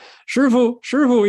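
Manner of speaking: high, excited calling out; excited disciple. Acted. Disciple runs in to tell master the news, excited high call. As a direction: call eagerly for the teacher.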